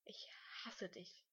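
slow, soft, true whisper; stubborn whisper. A narrator's reading. Crying boy repeats his hate to his rival, whispering slowly. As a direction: whisper it slowly, dwelling on each word. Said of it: "flüsterte Lukas hartnäckig".

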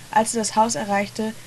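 usual speaking voice

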